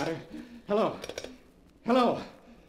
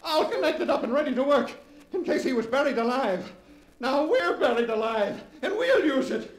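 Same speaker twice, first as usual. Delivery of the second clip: high, laughing, nearly shouting; gleeful triumph; 6 semitones higher than usual. Acted. Leonard tells his wife about the phone, laughing triumph.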